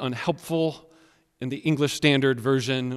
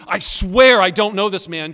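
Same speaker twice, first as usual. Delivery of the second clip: louder, higher oath with a punched "swear"; intensified sworn denial; 5 semitones higher, 9 dB louder than usual. Acted. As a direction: swear it forcefully, one step up. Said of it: "the progression and the intensity".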